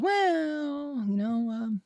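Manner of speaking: high, hesitant doctor's voice; mock doctor. Patient imitating the hedging doctor, high and hesitant. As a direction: hem and haw like a cagey doctor. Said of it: "and he goes".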